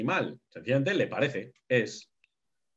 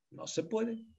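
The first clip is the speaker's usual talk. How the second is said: No se puede, much higher and far softer with puede rising; soft high echo; 9 semitones higher, 8 dB quieter, slower than usual. Shown as a demonstration. Teacher echoes his rule to the class, a soft high afterthought. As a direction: let it float away like an echo. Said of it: "No se puede hablar del sueño".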